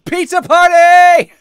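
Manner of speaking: high, slow, loud party cheer; excited shout. Acted. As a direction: yell it like a celebration. Voicing game character cheer.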